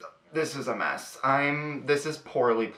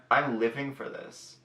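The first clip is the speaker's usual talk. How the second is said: ordinary talk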